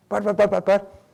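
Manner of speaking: high, stammered mock protest; sputtering protest. Guilt-tripper losing the argument, sputtering in protest.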